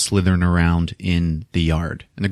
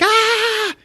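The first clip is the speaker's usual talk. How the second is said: very high comic shriek; long squeal of pretend horror; mock frightened scream. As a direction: imitate a terrified girl's scream. Girl screams at the cat.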